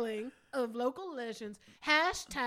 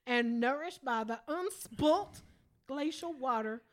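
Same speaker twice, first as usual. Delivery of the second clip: put-on Southern accent read. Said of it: "impression of a Southern accent".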